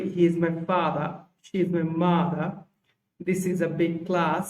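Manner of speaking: slow, careful British pronunciation, no r sounds; British accent demo, "father" as "fada", "class" with a long a; British English. Shown as a demonstration. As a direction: drop every r, slowly. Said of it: "এই সমস্ত বিষয়গুলো British English".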